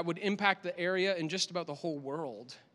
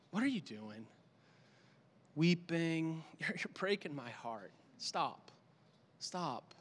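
compassionate reply; 7 dB quieter, slower than usual. Acted. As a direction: gently tell them to stop weeping. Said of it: "in a tone of compassion and care".

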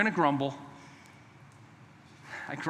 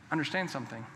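normal talking voice

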